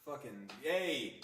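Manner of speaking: usual speaking voice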